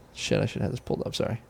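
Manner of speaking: under his breath, barely voiced, to himself; muttered aside. Real, not acted. Host searching his phone mid-show, muttering under his breath. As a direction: mutter it away from the mic while searching.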